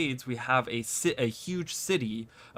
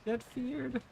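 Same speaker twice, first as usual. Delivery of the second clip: panicked; 12 semitones higher, 6 dB quieter than usual. Real, not acted. Urging team in panic.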